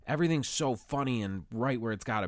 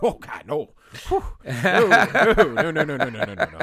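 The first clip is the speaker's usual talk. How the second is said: much louder and higher, laughing denial; relieved laughing no; 7 semitones higher, 11 dB louder, slower than usual. Retold or imitated. Told she wants Amir, loud relieved laughing denial. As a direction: laugh out a flood of no's. Relief, not the wanted man.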